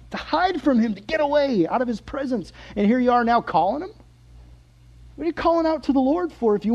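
high, exasperated questioning; baffled, rising protest; incredulous scolding. Acted. Acted scolding of Jonah.

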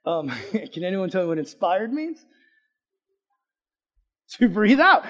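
ordinary delivery

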